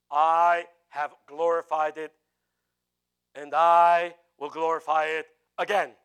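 loud, slow, booming proclamation; voice from heaven. Acted. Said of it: "Then a voice came from where?".

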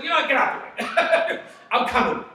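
high, loud call laughing into it; voice of the Lord. Acted. Pastor voicing the Lord, high and loud. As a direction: declare God's arrival with a grin. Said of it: "the Lord says".

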